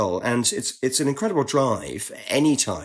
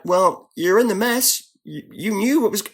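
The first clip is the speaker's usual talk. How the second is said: a hint of a brisk ambassador; 7 semitones higher than usual. British ambassador on phone.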